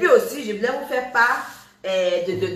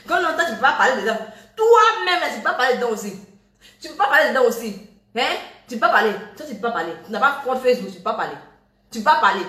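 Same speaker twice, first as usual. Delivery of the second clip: high, loud, fast bursts of real exasperation; fed-up scolding; 3 semitones higher, 5 dB louder, much faster than usual. Real, not acted. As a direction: tell them off, punching out each rebuke. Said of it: "exprimer mon ras-le-bol".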